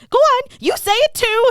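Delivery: high, loud mock yell; Buffy. Buffy freaking out at someone staring, mock yelling. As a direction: snap at onlookers, voice leaping way up. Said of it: "yells at them".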